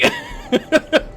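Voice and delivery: shrill laughing burst; high squeal of laughter. Real, not acted. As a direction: crack up high. I was dying.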